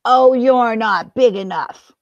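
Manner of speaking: grouchy scoff. Acted. Grouchy ladybug dismisses.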